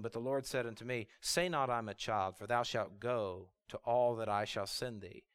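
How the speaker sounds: low, soft scripture reading; hushed, measured divine reply; voice of God. Acted. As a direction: answer with quiet, low authority. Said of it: "And God said to him".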